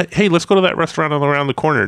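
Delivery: brisk acted-out invitation to a friend; eager suggestion. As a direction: pitch it up like a sudden idea. Acting out a confused suggestion.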